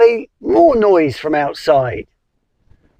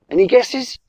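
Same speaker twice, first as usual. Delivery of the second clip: usual speaking voice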